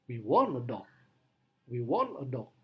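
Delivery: demo, want stressed both times. Shown as a demonstration. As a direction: hit want twice. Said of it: "penekannya pada kata want".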